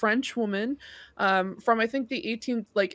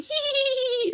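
high creepy giggling hee hee; demonic baby giggle. Retold or imitated. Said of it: "bloody baby chasing after you on four legs, going".

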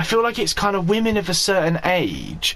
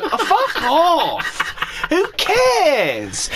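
laughing outburst; 9 semitones higher, much slower than usual. Real, not acted. Laughing exasperated outburst at ancestry.

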